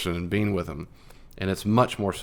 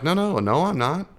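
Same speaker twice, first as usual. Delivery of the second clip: mock flustered protest, pitched higher; higher, hurried, exasperated no-no-no; frustrated denial; 8 semitones higher than usual. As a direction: protest your innocence, a bit exasperated. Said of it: "they're frustrated, sad, like".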